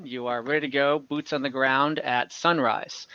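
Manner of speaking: ordinary talk, nothing marked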